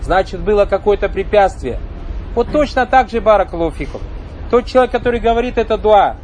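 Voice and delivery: ordinary delivery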